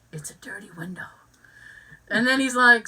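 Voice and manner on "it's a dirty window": soft unvoiced whisper of a joke; whispered deadpan. Contestant muttering to his neighbour, whispered and deadpan. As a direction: breathe it out like a secret aside.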